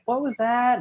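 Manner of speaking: very high-pitched mock mom cry; high, startled mother impression. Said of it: "his mom is like".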